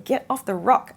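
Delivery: the universe ordering someone to move; urgent command. Acted.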